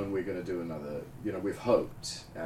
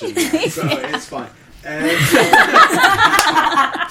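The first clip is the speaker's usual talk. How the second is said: laughing; 15 dB louder, much slower than usual. Real, not acted. Laughing about the witch threesome.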